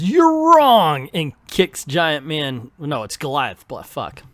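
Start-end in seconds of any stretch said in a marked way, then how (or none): 0.0-1.1 high, loud shout; yell. Acted. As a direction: bark it with a kick. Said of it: "Luke Cage yells".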